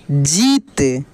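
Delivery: जीत with a long, stretched ī, pitch falling low; drawn-out long ī. Shown as a demonstration. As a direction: stretch the ī in जीत as a teacher demonstrating. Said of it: "इसका उच्चारण खींच कर होता है". (जीत की ई खींच कर)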